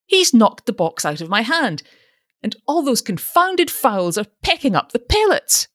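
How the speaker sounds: outraged at the pecking hens; indignant old man. A narrator's reading. Father indignant about fowls.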